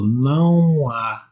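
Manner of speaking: "não há" stretched slowly into one continuous sound; long, linked "não há"; vowels run together. Shown as a demonstration. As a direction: draw out "não" and glide into "há" without a break. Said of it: "ele ficou junto com".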